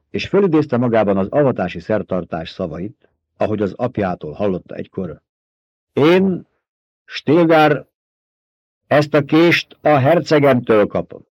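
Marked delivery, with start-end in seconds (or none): 5.9-11.3 loud, higher, commanding ducal voice; Paul proclaiming. A narrator's reading. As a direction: declare it like a lord before his people. Paul leads the oath. (ünnepélyes, parancsoló hercegi hang)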